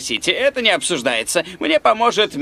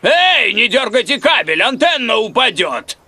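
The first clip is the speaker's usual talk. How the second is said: high, raised warning shout; alarmed call; 4 semitones higher, 3 dB louder than usual. Acted. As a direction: shout down urgently from the roof. (встревоженный окрик сверху)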